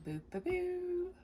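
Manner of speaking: high, slow sing-song boop boop boo; playful boops. Real, not acted. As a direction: hum a little tune as you drop the pieces in. Sings sound effect placing blocks.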